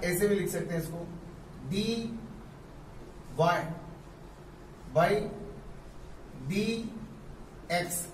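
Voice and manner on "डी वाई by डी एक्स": each symbol on its own with long pauses; teacher spelling out d y by d x; slow spelled-out reading. Shown as a demonstration. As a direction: dictate it slowly. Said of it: "ऐसे पढ़ते हैं".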